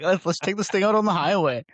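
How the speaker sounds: eager, high and loud; hyped to take it for a spin; excited. Pretend. Excited joyride quote.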